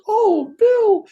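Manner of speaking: high falsetto tearful plea; guru's wife crying. Retold or imitated. Guru's wife sees the injured foot, high tearful apology. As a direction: imitate a weeping woman, pitched far up. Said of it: "she starts crying, tears coming down".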